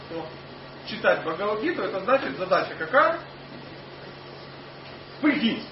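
ordinary talk

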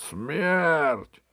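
high, stretched cry; shouting courtiers. A narrator's reading. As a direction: echo the crowd's demand for execution. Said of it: "закричали вельможи".

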